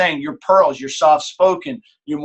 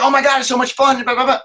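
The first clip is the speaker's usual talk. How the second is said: much higher, gushing over the fun; bubbly sales rush, way too eager; overexcited gush; 8 semitones higher, faster than usual. Shown as a demonstration. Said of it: "If I come in there like".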